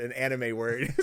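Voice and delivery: ordinary talk, nothing marked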